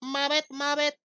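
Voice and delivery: tiny high squeaky frog; the baby frog chirping its word. Pretend. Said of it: "Baby Frog goes".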